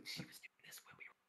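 hushed aside in a whisper; very soft, secretive whisper. Pretend. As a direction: whisper it confidentially. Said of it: "Why are we whispering?".

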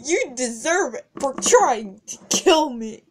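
normal talking voice

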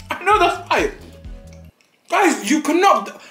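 normal talking voice

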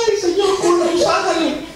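high, drawn-out imitation of crying; mock tearful wail. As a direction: wail it out slowly, imitating a weeping plea. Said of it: "Y sale llorando". (lamento llorón imitado)

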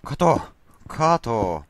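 sudden high, loud cry of look; shouted call. Retold or imitated. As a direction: shout it out like a crowd calling. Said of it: "Kaikki huusi kato". (kovaääninen huuto)

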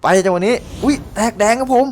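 high, loud burst of surprise; startled delight at the rare drop; excited yelp. Real, not acted. Gamer sees rare pull, surprised excited cry.

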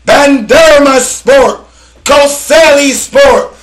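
high, loud, slow stretched club names; hype roll call. Real, not acted. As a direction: call them out like a stadium announcer. Rapid list of Turkish clubs.

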